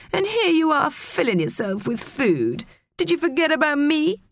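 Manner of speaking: high-pitched petulant whine, swooping down and up; whining. A narrator's reading. Maria finds Teo feasting without her, whining high. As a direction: complain in a high, aggrieved whine. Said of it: "she whined at Teo".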